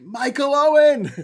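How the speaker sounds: loud, high, drawn-out football chant; proud Scouser yelling a footballer's name; shouting Michael Owen. Retold or imitated. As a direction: bellow the name like a fan on the terraces. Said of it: "And he was like".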